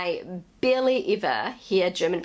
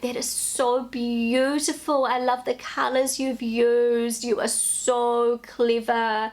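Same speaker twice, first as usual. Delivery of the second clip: slow, higher-pitched cooing parent; gushing praise; 4 semitones higher, slower than usual. Retold or imitated. Parent praising a child's scribble, slow gushing praise. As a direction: draw out the compliments as if to a small child. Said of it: "give their children unnecessary compliments".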